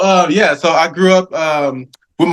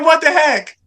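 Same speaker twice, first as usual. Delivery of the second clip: exasperated surprise; 13 semitones higher than usual. Retold or imitated. Exasperated that all knew.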